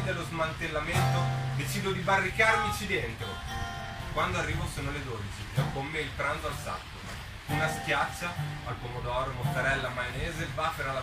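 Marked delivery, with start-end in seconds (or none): none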